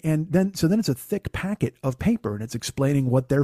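usual speaking voice

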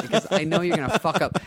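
ordinary delivery

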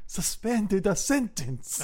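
high, slow mock Italian accent. Repeating the film line his way, hammed-up Italian accent. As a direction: stretch it in a Chico Marx style Italian accent. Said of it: "That accent, it's like Chico Marx".

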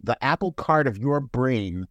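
ordinary delivery

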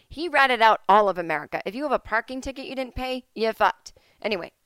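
normal talking voice

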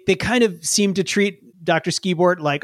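ordinary delivery

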